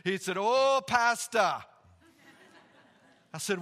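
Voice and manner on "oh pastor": put-on loud, high, drawn-out Ugandan accent. Stranded man greeting the visiting pastor, in a Ugandan accent. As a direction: greet him slowly, loudly in a Ugandan accent. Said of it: "This is an awesome accent".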